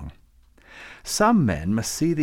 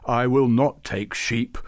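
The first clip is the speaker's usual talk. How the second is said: mild roar; 5 dB louder, slower than usual. Acted. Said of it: "roared Babucar".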